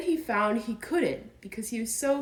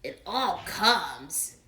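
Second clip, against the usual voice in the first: stern; much slower than usual. Acted. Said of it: "said Rabbit sternly".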